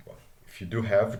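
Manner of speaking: ordinary talk, nothing marked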